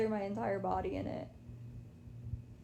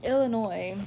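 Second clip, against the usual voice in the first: normal talking voice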